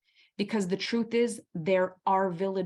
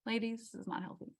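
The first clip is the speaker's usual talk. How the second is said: soft aside; 11 dB quieter, faster than usual. Real, not acted. Aside to the women listening.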